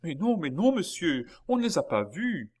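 high, loud, urgent denial; alarmed reply. A narrator's reading. Maid answering the anxious baron, alarmed and insistent.

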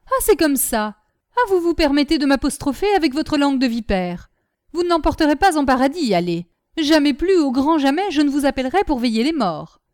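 loud, high-pitched quarrelling; angry outburst. A narrator's reading. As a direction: rail at her in a temper. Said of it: "leur fit honte de leur emportement".

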